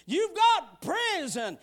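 normal talking voice

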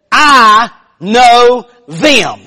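"I know them" loud, word by word; emphatic verse quote. Retold or imitated. Quoting the verse that struck her.

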